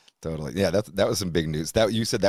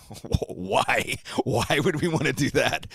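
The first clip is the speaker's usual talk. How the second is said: retold incredulous why; 7 semitones higher, slower than usual. Retold baffled reply to buddy's album idea.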